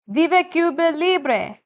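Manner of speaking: high cheering cry of the crowd; screaming crowd. A narrator's reading. Crowd on the roadway screaming for a free Cuba, high cheering cry. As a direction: shout it up high like a crowd in the street.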